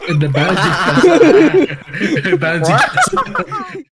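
giggling through the words; laughing. Real, not acted. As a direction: laugh it out, delighted. Laughing at a teammate's jump.

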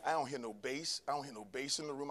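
normal talking voice

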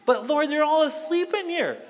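high-pitched objection to the Lord; retold incredulous pleading; pleading protest. Acted protest to God.

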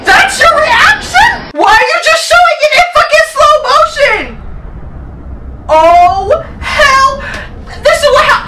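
high, loud frantic outrage; outraged yelling. Real, not acted. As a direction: yell at the screen in disbelief. Yelling at the video.

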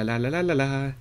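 ordinary talk, nothing marked

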